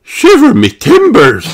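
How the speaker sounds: high, loud exclamation of amazement; astonished gasp. A narrator's reading. Miss Rabbit suddenly sees the monster, astonished. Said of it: "gasped Miss Rabbit".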